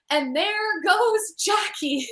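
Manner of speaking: play-by-play call stretched out slowly; a light Bob Costas broadcaster impression; imitating Bob Costas. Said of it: "Bob Costas go".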